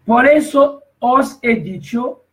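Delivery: preacher booming the verse; emphatic quote. Acted. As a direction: proclaim Christ's words to the congregation. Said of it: "Mira que dijo Cristo mismo".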